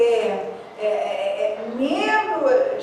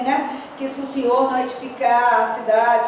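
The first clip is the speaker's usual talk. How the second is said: ordinary talk, nothing marked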